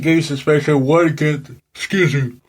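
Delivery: words stretched by a yawn. Real, not acted. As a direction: yawn mid-sentence. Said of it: "apologize, folks, for yawning".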